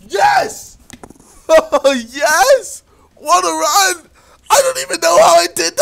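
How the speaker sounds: high, loud cheer with laughter; real elated shouting. Real, not acted. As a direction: whoop in disbelief at your own win. Celebrates an unexpected success.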